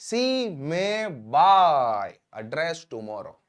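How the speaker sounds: very slow, word by word, for learners; slow dictation. Shown as a demonstration. Teacher to students, slow clear dictation. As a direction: spell it out slowly so students can write. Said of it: "teacher dictates English model sentence".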